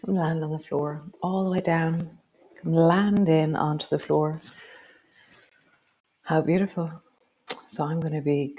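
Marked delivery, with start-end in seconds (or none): 0.0-6.9 guided movement cue. Acted. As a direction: guide the body gently through each move. Leads a guided movement practice.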